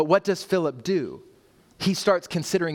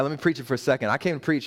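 ordinary delivery

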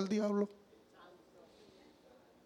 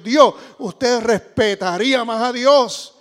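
slow, loud, drawn-out preaching; full-voiced sermon cry; fiery preaching; 4 semitones higher, 9 dB louder than usual. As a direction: proclaim it to the congregation.

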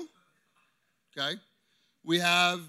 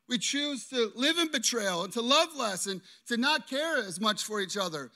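usual speaking voice

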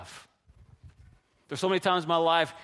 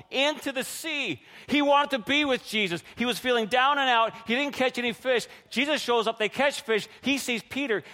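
high, urgent, driving narration; excited storytelling; 5 semitones higher than usual. Real, not acted. Preacher telling how Peter rushed to Jesus, excited. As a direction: carry the rush in a raised, fervent voice.

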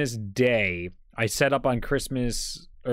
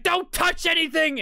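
very high, slow scolding grandma. Retold or imitated. Grandma telling grandpa to stop fiddling, very high and slow. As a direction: warn him off like an exasperated grandma. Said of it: "She's like".

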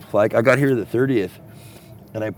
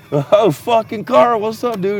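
mock cheerful; 11 semitones higher, 5 dB louder, slower than usual. Greeting crazy neighbor, acted quote.

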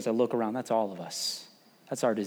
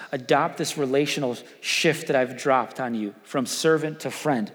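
ordinary talk

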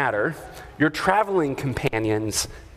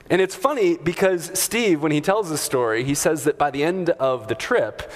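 normal talking voice

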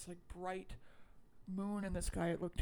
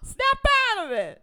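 high, loud shouted command; famous shout; 14 dB louder than usual. Retold or imitated. Loretta slapping Ronnie, shouting him out of it. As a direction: yell it to slap sense into him. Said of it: "this is the famous slap".

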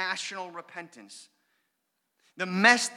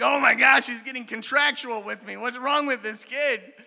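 amused disbelief; 5 semitones higher, 6 dB louder than usual. Retold or imitated. Dad's amused disbelief at his son.

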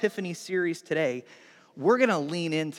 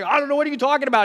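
high, louder puzzled reply; excited bafflement; 9 semitones higher, 6 dB louder, much faster than usual. Acted. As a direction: answer loudly, completely in the dark. Acting the puzzled other fisherman.

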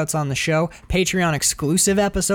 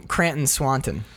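ordinary delivery

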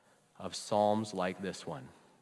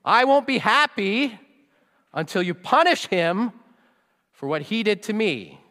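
put-on very high, loud demanding kid voice; hurt child insisting his brother get punished; whiny child; 13 semitones higher, 16 dB louder than usual. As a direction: wail it like a wronged little kid. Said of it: "the kid is like".

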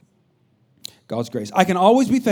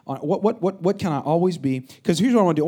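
ordinary talk, nothing marked

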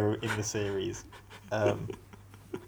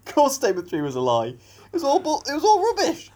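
high laughing exasperation; 6 dB louder than usual. Real, not acted. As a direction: laugh it out in disbelief. Laughing at being fooled.

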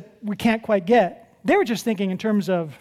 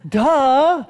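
louder, higher scoffing "duh"; mock duh; 4 semitones higher, 6 dB louder than usual. Preacher mocks a weary pastor's complaint with a loud scoff.

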